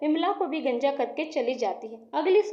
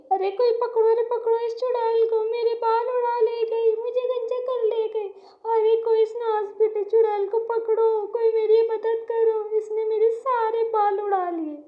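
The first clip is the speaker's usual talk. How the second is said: high, loud tearful cry for help; loud wailing; 8 semitones higher, 4 dB louder than usual. Acted. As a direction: wail desperately for someone to help. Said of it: "Pushpa जोर जोर से रोती".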